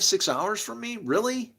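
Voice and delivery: normal talking voice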